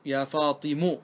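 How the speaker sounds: "فاطم" ending in u, as fatimu; final damma. Shown as a demonstration. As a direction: add an oo vowel to the end of "فاطم". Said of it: "نبنيها على الضم".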